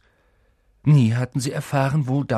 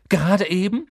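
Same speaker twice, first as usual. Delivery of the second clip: high, loud dismayed question; desperate; 10 semitones higher, 5 dB louder than usual. A narrator's reading. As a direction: voice climbing in disbelief. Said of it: "sagte Harry verzweifelt".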